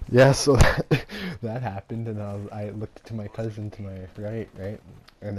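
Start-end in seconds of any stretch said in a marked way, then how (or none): 0.1-1.1 laughing. Real, not acted. Bursts out laughing mid-sentence.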